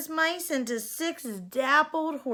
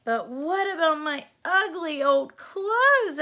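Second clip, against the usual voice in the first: worried question; 5 semitones higher, 5 dB louder than usual. A narrator's reading. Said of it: "asked Cindy".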